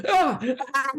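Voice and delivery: very high, loud shriek of pain. Acted. Girl stabbed in the shoulder, shrieking. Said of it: "she shrieks".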